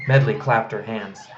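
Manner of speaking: ordinary delivery, nothing marked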